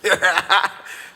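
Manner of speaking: short loud laugh; amused laugh. Real, not acted. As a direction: laugh at the question. Asked for the truth.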